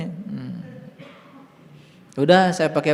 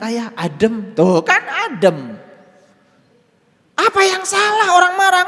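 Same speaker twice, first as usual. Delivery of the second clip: forceful preaching; 13 semitones higher, 9 dB louder than usual. Acted. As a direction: proclaim it to the whole room. Preaching that anger is no sin.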